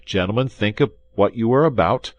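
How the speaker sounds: emphatic cautioning cry; exclamation. A narrator's reading. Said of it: "exclaimed D'Artagnan".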